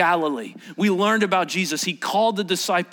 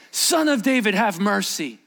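crying out; 3 semitones higher than usual. Acted. Blind man calling to Jesus in Luke's account, crying out. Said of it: "he cried out all the more".

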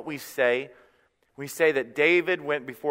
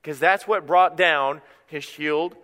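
ordinary delivery

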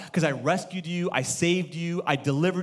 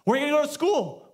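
much higher, chirpy questioning voice; mock adult asking; 9 semitones higher, faster than usual. Pastor voices an adult asking an Israelite kid, mock cheerful. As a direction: play an eager grown-up quizzing a kid.

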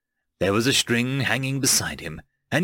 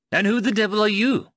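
high-pitched indignant challenge; demanding; 9 semitones higher, 3 dB louder than usual. A narrator's reading. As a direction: demand an answer from the intruder. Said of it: "demanded Ben".

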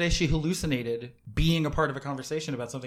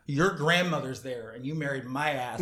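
ordinary delivery

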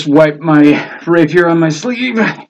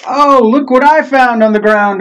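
innocent act; 9 semitones higher than usual. Acted. Character bluffs finding an item.

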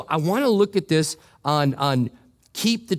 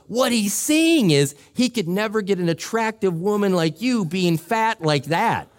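higher mock-blunt friend, laughing; friend blurting out; 6 semitones higher than usual. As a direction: blurt it out like a friend breaking the man code. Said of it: "he goes".

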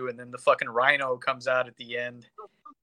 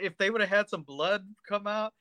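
ordinary delivery

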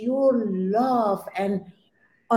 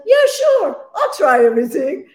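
much higher, louder, quick eager agreement; eager yes; 8 semitones higher, 6 dB louder than usual. Retold or imitated. Accepting a dancer's offer of a healer, eager. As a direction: jump at the offer, fast and bright.

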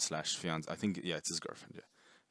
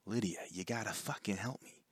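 higher-pitched mock New York accent; 4 semitones higher than usual. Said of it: "Like, it goes from".

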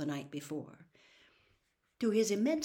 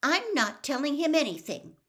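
curt, louder refusal; short; 9 semitones higher, 8 dB louder than usual. A narrator's reading. Hermione refuses to carry messages, curt and raised. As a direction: cut him off sharply. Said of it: "Hermione said shortly".

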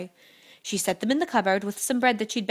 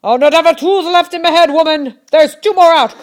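high, very loud giant's howl; shouting even louder; 7 semitones higher, 13 dB louder than usual. A narrator's reading. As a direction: yell in outrage at the lost teeth. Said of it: "shouted even louder".

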